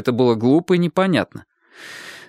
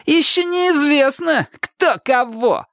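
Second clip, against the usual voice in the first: very high, drawn-out challenge; high, slow, brazen boast; cocky and chipper; slower than usual. A narrator's reading. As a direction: throw down a cheerful dare. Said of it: "бодро добавил".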